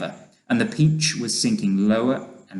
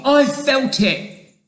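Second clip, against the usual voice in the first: high, loud, slow protest; high-pitched emphatic shout; shouted insistence; 14 semitones higher, 6 dB louder, slower than usual. A narrator's reading. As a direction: insist at full voice. Shouted argument about lifting.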